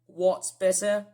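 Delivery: "better" drawn out in a British accent; British pronunciation. Shown as a demonstration. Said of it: "British people often say the word better".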